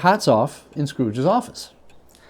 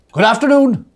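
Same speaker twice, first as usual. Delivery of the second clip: sharp dismissal; 9 semitones higher, 8 dB louder than usual. A narrator's reading. Scrooge dismisses him louder.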